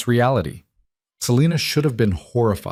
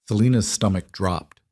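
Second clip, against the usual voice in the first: ordinary delivery, nothing marked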